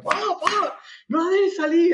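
high, shrill frantic cries; panicked yelling. Retold or imitated. Narrator chasing the cockroach, panicked high yell. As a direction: shout while swinging the broom. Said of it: "Entraba a mi cuarto pegando golpes".

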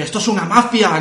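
loud angry cry of mafia; yelling it in a panic; retold shout. (grito exaltado)

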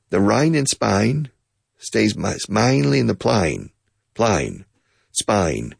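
Australian vowels, Spain said like spine; a mild mock Aussie accent. Teacher imitating Australians saying the phrase, mild Australian accent. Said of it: "the Australians pronounce Spain".

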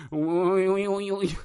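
sing-song string of uys, running together; drawn-out "uy". Pretend. Podcaster interrupts his own argument, wavering string of uys. Spelt wordless exclamation.

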